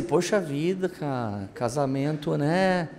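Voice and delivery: hushed, low-key sigh of a line; imagined gentle remark. Retold or imitated. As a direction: voice a wife's unspoken thought quietly. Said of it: "Ela tava querendo dizer assim".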